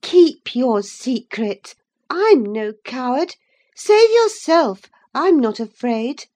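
high, loud proud disdain; scornful compassion. A narrator's reading. Catherine answering Linton with scornful compassion. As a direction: pity him but scorn his fear. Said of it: "with scornful compassion".

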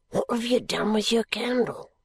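put-on hiss-free, secretive old man's whisper. A narrator's reading. Old man asking the lawyer about his candle, whispered. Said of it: "Thus whispering".

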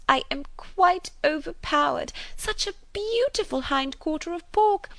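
high, gushing spinster, swooping pitch; fulsome, overwhelmed gratitude; effusive chatterbox. A narrator's reading. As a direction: overflow with thanks and excitement.

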